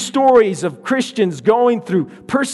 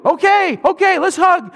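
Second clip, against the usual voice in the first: put-on much higher, sniffly child; little boy voice; 11 semitones higher than usual. Hurt boy accepting sister's hug, high child voice. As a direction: give in like a teary toddler.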